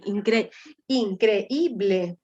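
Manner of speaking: increíble drawn out very slowly, in-cre-í-ble; slow syllable demo. Shown as a demonstration. Teacher answering a student, slowly sounding out increíble. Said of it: "Claro, porque lleva tilde".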